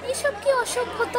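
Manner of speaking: indignant high snap at him; angry. A narrator's reading. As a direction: scold him in outrage. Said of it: "Radha রেগে গিয়ে বিট্টুকে বলে".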